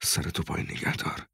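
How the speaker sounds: very soft, low murmur; muttered under breath. A narrator's reading. Parent telling child to keep down, muttered. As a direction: murmur it, barely voiced. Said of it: "زیر لب گفت".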